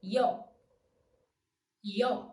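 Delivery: I and O in a single emission; yo as one sound. Shown as a demonstration. Teacher modelling yo for learners as one diphthong. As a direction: glide Y straight into O. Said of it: "pronounce the diphthong with a single emission of voice".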